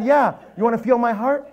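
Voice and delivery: loud, high, worked-up reply; comic shaken outburst; retold panic. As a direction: insist in mock fright. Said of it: "I was scared".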